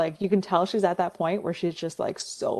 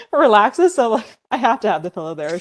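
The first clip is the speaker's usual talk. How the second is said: normal talking voice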